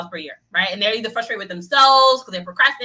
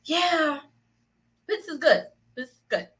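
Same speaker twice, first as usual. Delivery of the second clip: higher, half-hearted approval; lukewarm yeah; 7 semitones higher, slower than usual. Acted. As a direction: say it's good without conviction. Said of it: "you're still saying like".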